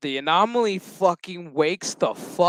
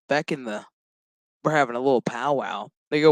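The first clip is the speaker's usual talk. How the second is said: ordinary delivery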